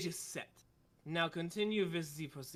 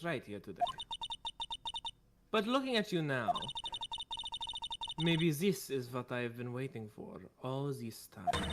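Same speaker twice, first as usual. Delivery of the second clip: put-on German accent. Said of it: "It's so hard to do the accent".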